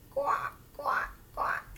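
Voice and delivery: whispered quacking. Acted. Duck sound again.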